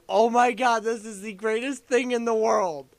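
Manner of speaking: high, loud retold elation; ecstatic outburst. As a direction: relive it at full gushing excitement. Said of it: "I was so fucking ecstatic".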